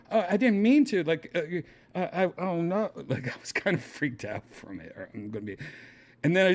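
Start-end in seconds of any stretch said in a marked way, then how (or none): 0.1-2.9 high, stammered retold apology; mock panicked, faltering excuse; in shock. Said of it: "in shock going".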